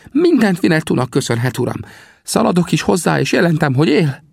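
very high, animated character voice; high-voiced, breathlessly eager sidekick; excitable Sam, bursting with news. A narrator's reading.

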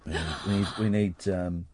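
normal talking voice

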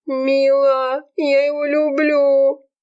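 high, drawn-out lovesick wail; suffering wail. A narrator's reading. Serafima confesses love to her friend, wailing. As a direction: stretch the words into a high wail of heartbreak. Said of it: "продолжала страдать Серафима".

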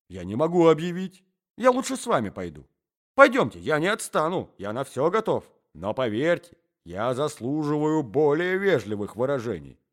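usual speaking voice

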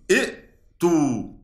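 ائتو drawn out very slowly with both hamzas; two hamzas sounded. Shown as a demonstration. Teacher showing students how ائتو sounds, slow. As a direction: stretch ائتو so the silent hamza after the first is heard. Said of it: "دي همزة ساكنة اللي هي همزة القطع".

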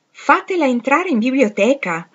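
loud, high-pitched command; forceful, impatient instruction; cutting short. A narrator's reading. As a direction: shut down the argument with an order. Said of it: "tagliò corto l'angelo".

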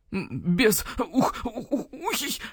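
choked, wheezy gasps between hoots; hoot-broken wheeze of a choking owl; wheezing. Acted. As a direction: squeeze it out while being strangled. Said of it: "просипел Сыч Уг".

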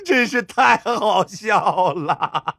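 gleeful high voice breaking into cackles; laughing hard. A narrator's reading. Shoemaker mocking a man killed by his own trap, laughing. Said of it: "铁鞋匠看清楚情况后捧腹大笑".

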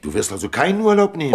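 höher, lauter und schnell, fassungslose Frage; empört nachhaken, als traue sie ihren Ohren nicht; ungläubig überrascht. Acted. Schauspielerin hört vom Urlaubsverzicht. (überraschte, laute Frage an den Freund)

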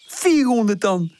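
high, stretched cheeky guess; joking. A narrator's reading. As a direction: tease with a drawn-out high question. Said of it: "zegt Molletje grappig". (grappend, hoog en langgerekt)

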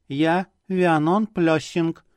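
slow, eerie doll voice; talking doll. A narrator's reading. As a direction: speak like a porcelain doll, slowly. Doll introduces herself.

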